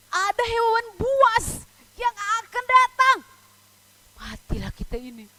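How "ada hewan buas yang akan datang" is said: loud, high warning of danger; hammed-up scare for young listeners; mock alarm. Voicing fears of those stranded. (peringatan seram yang lantang dibuat-buat)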